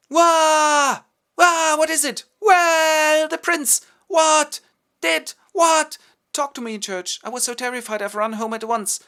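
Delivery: put-on high, loud, breathless girlish panic; frantic daughter bursting in; panicked young woman. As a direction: squeal it out like a terrified girl.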